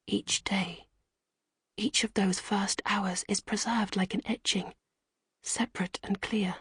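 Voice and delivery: hushed, breathy storytelling whisper; whispered narration. A narrator's reading. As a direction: tell it under your breath, like a secret.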